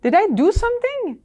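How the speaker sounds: high-pitched retold question; puzzled questioning. Asking coworker about client's treatment, puzzled.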